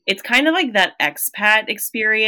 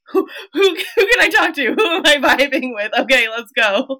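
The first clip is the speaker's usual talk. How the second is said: high, half-crying, half-laughing plea for a friend; mock desperate search; 6 semitones higher than usual. New mom abroad imagining who to talk to, pleading high. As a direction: pretend lost and pleading, then perking up. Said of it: "where you're just like".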